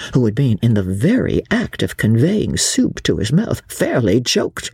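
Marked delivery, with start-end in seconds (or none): none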